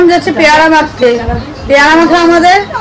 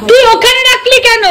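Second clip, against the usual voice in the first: high scolding; 12 semitones higher than usual. Real, not acted. Scolds the girl over the plate.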